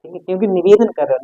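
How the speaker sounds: ordinary talk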